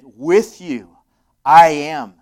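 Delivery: "with you" rising, then "I am." shouted high and loud; awed shout. Real, not acted. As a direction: cry it out in awe. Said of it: "That just gives me chills".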